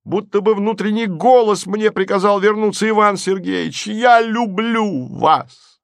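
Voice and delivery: высокий, восторженный крик влюблённого; признавайся в любви взахлёб, высоко; восторженное признание. A narrator's reading. Марик восторженно признаётся Голубовичу. (восторженно, на высоких нотах)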